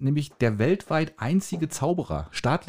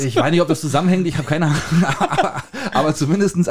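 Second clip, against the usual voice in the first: giggly, higher and louder; laughing while talking; 4 semitones higher, 5 dB louder than usual. Real, not acted. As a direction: laugh through the words. Laughing at S1's joke.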